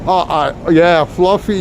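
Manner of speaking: ordinary talk, nothing marked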